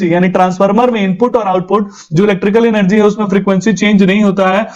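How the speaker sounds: normal talking voice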